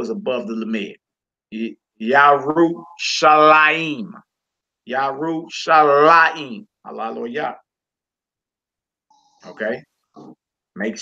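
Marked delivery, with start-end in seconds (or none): 1.5-6.7 "Yerushalayim" drawn out, punching "la"; stress on LA. Shown as a demonstration. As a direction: slowly say yah-roo-sha-LA-eem for "Yerushalayim". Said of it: "We put our emphasis over the Lamed".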